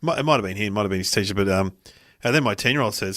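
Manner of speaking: normal talking voice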